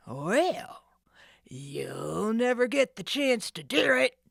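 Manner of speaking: loud breathy rasping taunt; gloating villain. Acted. The ringleader taunts Nancy, rasping gloat. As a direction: gloat over her.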